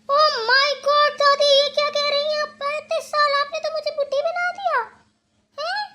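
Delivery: high, loud, scandalised exclamation; shocked outrage. Acted. Told by grandmother she is thirty-five, shocked and offended. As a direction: wail it high as if mortally insulted.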